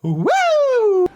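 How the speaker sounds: high delighted whoop; excited woo. Retold or imitated. Retelling girlfriend leaving Bernie's room, high thrilled whoop. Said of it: "she's like".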